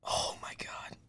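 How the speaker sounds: voiceless, very soft whisper. Real, not acted. Streamer alone awaiting his team's pick, whispered. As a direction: whisper it to yourself in suspense.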